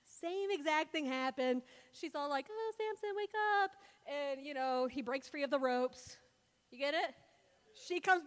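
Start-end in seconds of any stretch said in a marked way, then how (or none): 2.5-3.7 high, sing-out fake warning; a put-on high wake-up call, feigning concern; Delilah's mock alarm. Woman waking Samson once he is tied, mock alarm.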